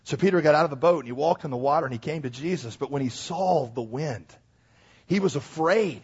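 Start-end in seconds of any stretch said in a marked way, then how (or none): none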